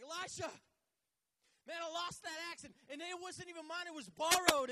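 high, loud, frantic plea; panicked outburst. Acted. Man running to Elisha about his lost axe, frantic. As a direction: call out urgently, peaking on the last words.

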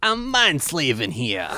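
put-on mind-controlled Joey in a Brooklyn accent. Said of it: "forced to use a Brooklyn accent".

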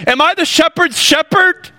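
high, scoffing retort; indignant Cain. Acted. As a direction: toss it back as a sarcastic high question. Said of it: "You, you could hear him say".

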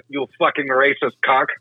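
ordinary delivery

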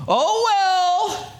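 high, breezy, dismissive oh well; mock shrugging oh well; flippant oh well. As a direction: toss it off like it doesn't matter. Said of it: "this wasn't for Paul just going".

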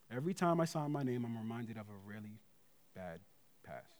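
quiet, low, heavy delivery; somber. Retold or imitated. Friend asked about signing his name, answer given somberly. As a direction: let it sink softly, with pauses before the last words.

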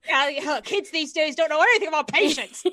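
kids these days, hammed-up grump; mock grumpy old-timer. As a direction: play a cranky old man lightly.

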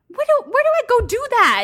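high, loud flustered question; half-joking panicked what-do-I-do; alarmed disbelief. Retold or imitated. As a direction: blurt it out as if in panic. Panicked quote to husband.

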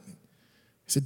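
usual speaking voice

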